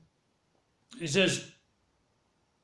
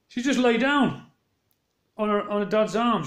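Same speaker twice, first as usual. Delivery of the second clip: amazed retelling; 5 semitones higher than usual. Real, not acted. Retelling the baby's odd behaviour.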